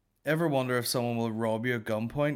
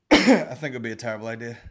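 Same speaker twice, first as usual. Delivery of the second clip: ordinary talk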